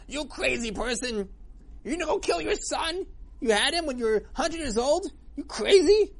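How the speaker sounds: high furious yell, loudest at the end; screaming at him. Acted. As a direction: explode at him in a high shrieking rage. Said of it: "started screaming him".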